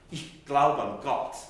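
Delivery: loud, slow stresses on "glaube" and "Gott"; German pressure accent. Shown as a demonstration. Teacher showing German accentuation, heavily pressed stresses. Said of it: "it's even more so".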